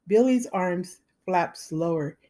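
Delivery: ordinary delivery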